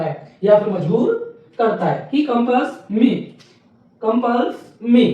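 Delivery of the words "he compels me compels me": each word drawn out slowly; slow dictation. Shown as a demonstration. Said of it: "slow dictation demo". (बहुत धीरे-धीरे बोलकर लिखवाना)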